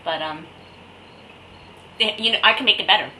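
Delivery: ordinary talk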